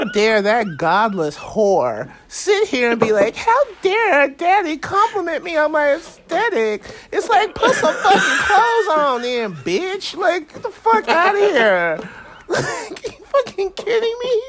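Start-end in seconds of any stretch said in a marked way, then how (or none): 3.3-6.8 much higher mock-indignant whine; mocking John. Mocking absent John to the hosts, high mock-offended whine. 7.1-14.4 much higher, laughing mock tirade; hammed-up diva scolding, half laughing; mock outrage. Trash-talking John while he's away, high laughing mock outrage.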